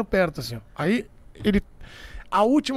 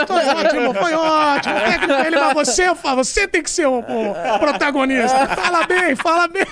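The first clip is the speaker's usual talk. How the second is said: higher and louder, laughing encouragement; mock enthusiastic praise; 6 semitones higher, 8 dB louder than usual. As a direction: hype up the doctor like a star. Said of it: "Como dizendo".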